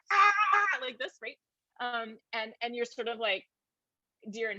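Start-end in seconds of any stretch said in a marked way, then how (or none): 0.1-0.8 high squawky Kermit cry; a startled high Kermit yelp; Kermit meme yelp. Retold or imitated. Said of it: "Kermit's like".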